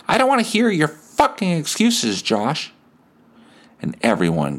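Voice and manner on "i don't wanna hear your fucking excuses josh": put-on sudden old lady snap. Old lady swears at boy.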